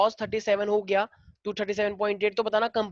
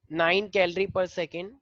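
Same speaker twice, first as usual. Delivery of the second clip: slow read-aloud, dropping softer at the end; question dictation; 3 semitones lower, 4 dB quieter, much slower than usual. Real, not acted. Teacher repeating the question for students, slow dictation. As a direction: repeat it slowly for note-takers.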